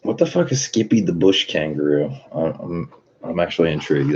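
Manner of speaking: ordinary delivery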